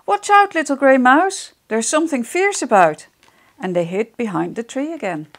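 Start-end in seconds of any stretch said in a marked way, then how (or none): 0.0-3.1 alarmed warning. Acted. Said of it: "Millie and Pink-Nosed Rabbit peeped out".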